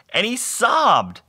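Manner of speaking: high, drawn-out wail on "sobbed"; hammed-up weepy storytelling; mock sobbing narration. A narrator's reading. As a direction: stretch "sobbed" into a slow, high mock cry. Narrator escalates a mock sob.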